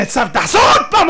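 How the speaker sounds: loud, high double-take; startled demand. Acted. Isaac making Esau repeat himself, loudly startled. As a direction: cut in loudly, astonished.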